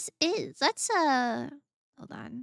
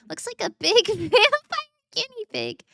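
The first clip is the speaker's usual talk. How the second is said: high, loud giggly delivery, much higher; laughing; 10 semitones higher, 11 dB louder than usual. Real, not acted. Amused by the odd animal picture, laughing. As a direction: laugh through the description.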